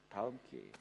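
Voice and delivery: low, very soft, giving up; quiet resignation. Acted. Beggars imagined accepting there is another chance, quiet resignation. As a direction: let it drop to a low murmur.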